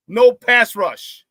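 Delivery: "No pass rush" very slow, leaning on "No"; slow stressed repeat. Shown as a demonstration. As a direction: stretch "No pass rush" out slowly. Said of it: "Say that again".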